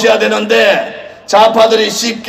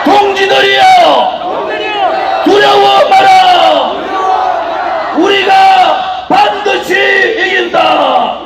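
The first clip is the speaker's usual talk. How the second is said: high, slow shouted battle cries; roaring rallying speech; 7 semitones higher, slower than usual. Acted. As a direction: roar each slogan at the crowd.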